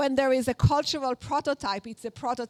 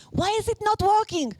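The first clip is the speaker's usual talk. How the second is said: put-on heavy Israeli accent, pitched up and pleading; 9 semitones higher than usual. Mother in labor asking why the epidural fails, thick Israeli accent. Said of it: "In the most Israeli accent it could be".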